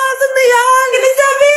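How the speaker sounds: high, insistent sibling bickering; squabbling cry. Acted. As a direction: demand it in a heated high voice. Siblings fight over inherited things.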